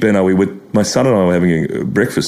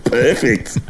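ordinary talk, nothing marked

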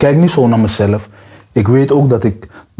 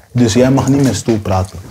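ordinary talk

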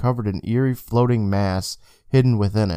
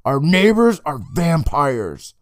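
slow dramatic reveal; 5 semitones higher, 3 dB louder, slower than usual. Acted.

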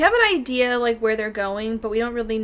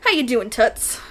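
put-on Transatlantic accent; 6 dB louder, slower than usual. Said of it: "transatlantic accent".

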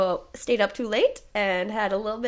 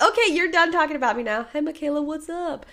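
mock sassy friend; 7 semitones higher, 5 dB louder than usual. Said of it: "She was like".

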